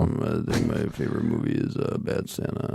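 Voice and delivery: vocal fry. Pretend. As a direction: push the voice down into a creaky fry. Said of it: "It's really bad vocal fry".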